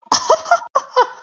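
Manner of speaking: very high squealy delighted giggle; high cartoon laugh. Owner laughing over Scamp, very high giggle. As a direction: laugh like an overjoyed cartoon character. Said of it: "how about this one where it goes".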